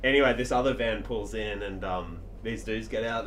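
ordinary talk

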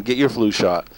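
ordinary talk, nothing marked

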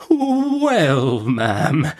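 high, drawn-out hesitant opening; long wavering 'well' from a meek doctor; timidly plucking up courage. A narrator's reading. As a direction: begin again very meekly. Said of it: "as soon as he had courage".

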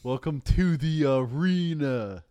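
announcer voice. Pretend. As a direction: present it like an arena host. Announces next stats section.

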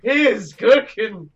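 loud, slow, high Rock-style boast; hammed-up wrestler catchphrase. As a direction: boom it out like a wrestling promo. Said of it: "I'm the Scott".

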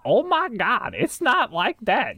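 high, slow mock-exasperated whine; mocking whiny friend. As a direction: parody the friend's overreaction.

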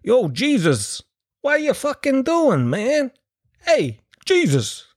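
hammed-up New York wiseguy, high and drawn out; Harvey Keitel. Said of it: "apostles all had fucking thick New York accents".

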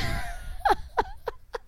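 real squealing laugh, very high; high-pitched laughter. Real, not acted. Host cracking up at his own joke, high laughter. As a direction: burst out in a high cackling laugh.

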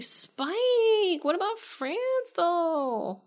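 high, slow, whiny plea; whiny girlfriend. Retold or imitated. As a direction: whine it up high and drawn out. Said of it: "she's all like".

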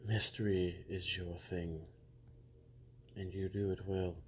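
ordinary talk, nothing marked

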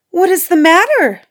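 a high, loud cry of alarm. Acted. Beauty seeing her prince lying weary, crying out high and loud. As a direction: cry out in sudden worry. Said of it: "she cried".

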